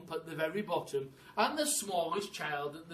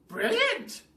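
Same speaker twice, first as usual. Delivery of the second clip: high, loud delighted cry; excited cry; 8 dB louder than usual. Acted. Missus Twit cries out in delight at the plan, high excited cry. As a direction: crow with glee at the plan. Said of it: "cried Missus Twit".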